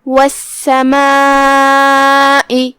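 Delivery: والسماء with the long aa held for four counts; slow chanted والسماء, vowel drawn long; four-count madd. Shown as a demonstration. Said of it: "ہم اپنی آواز مد واجب متصل میں چار مد کے برابر لمبی کرتے ہیں".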